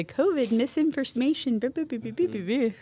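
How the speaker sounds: light sing-song mockery; mock warning label. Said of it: "It'll say".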